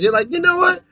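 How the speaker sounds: usual speaking voice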